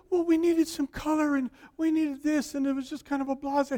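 wife explaining her decor, lightly voiced. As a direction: breeze through her excuse.

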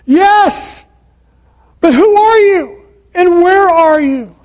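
high, loud holler upward; yelling back. Acted. Jack asking who is above him, yelling back. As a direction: shout the questions to someone out of sight. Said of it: "he yelled for a long time".

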